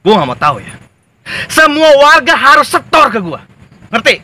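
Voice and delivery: loud thug barking orders; bully your men into obeying; bullying boss. Acted. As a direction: gang boss to henchmen, loud and bossy. Gang boss bullies henchmen.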